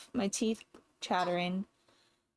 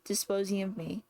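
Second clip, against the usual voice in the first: ordinary delivery, nothing marked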